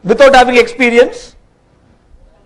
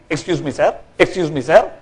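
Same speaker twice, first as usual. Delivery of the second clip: soft, low, deferential appeal; humble, hushed plea; meek request; 5 semitones lower, 5 dB quieter than usual. Acted. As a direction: politely try to get a superior's attention. Job seeker pleading with companies.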